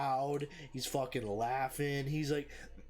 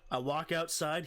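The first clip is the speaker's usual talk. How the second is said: usual speaking voice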